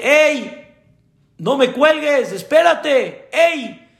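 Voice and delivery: high, urgent hailing; acted calling out. Doctor stopping the father hanging up, calling out. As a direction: call them back to the phone, pitched high.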